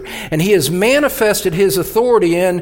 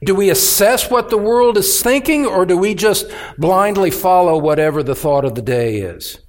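ordinary talk

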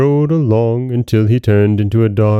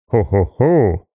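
acted ho-ho, repeating the laugh; echoed laugh. A narrator's reading. Unseen voice echoes the sexton, acted laugh. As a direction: mimic the chuckle back. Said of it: "repeated a voice close beside him".

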